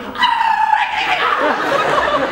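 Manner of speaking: very high, loud imitation scream; acted runaway shriek. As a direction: shriek like someone fleeing the room. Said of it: "Romero runs screaming from the room".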